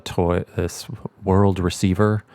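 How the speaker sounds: usual speaking voice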